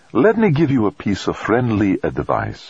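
hint of Italian accent, gentle priest; a light Italian lilt on the vowels; soft Italian accent. A narrator's reading. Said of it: "the hint of soft Italian vowels".

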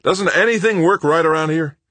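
high furious shout; bellowing. Acted. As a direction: yell it at the broken shower. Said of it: "he bellowed".

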